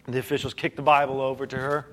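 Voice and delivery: normal talking voice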